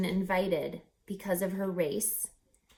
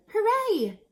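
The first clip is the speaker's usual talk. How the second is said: much higher joyful cheer; hooray; 13 semitones higher, 6 dB louder than usual. Acted. Reader cheering Ray's applauded skills, joyful. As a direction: celebrate with a happy cheer. Celebrating in story.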